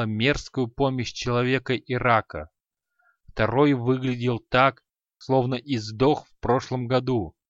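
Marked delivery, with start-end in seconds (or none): none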